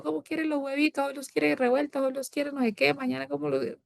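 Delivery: high, sing-song pampering mother; doting mother. Retold or imitated. Mother asking daughter about eggs, high doting coo. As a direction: coo over her breakfast order.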